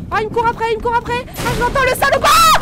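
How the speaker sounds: high, loud terrified shouting; panicked yell. Real, not acted. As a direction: scream in fear as it chases you. Chased, screams at monster.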